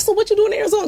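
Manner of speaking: high, loud, chummy question to a celebrity; overfamiliar fan. Retold or imitated. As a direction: act like new best friends. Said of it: "'Cause now we're friends".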